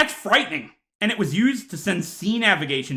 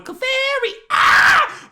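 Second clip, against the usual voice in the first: shrill, much higher creature cry; Clefairy scream; 5 dB louder, much slower than usual. Retold or imitated. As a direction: squeal like a blinded Clefairy. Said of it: "They're just going".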